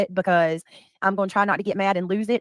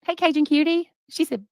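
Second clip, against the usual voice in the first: usual speaking voice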